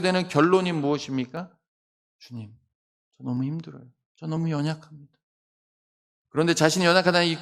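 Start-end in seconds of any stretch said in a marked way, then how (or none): 2.2-5.2 soft, low prayer of weakness; hushed acted plea to the Lord; quiet confession. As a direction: confess it under your voice, worn out. Acted weak confession to God.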